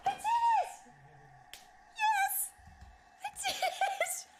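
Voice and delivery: squealing I did it; triumphant squeal. Real, not acted. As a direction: shout with pure joy at the win. Finally winning the fight.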